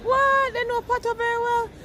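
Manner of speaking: high-pitched incredulous "what?!"; amazed reaction. Retold or imitated. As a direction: exclaim it high in astonished disbelief. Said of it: "And when I say".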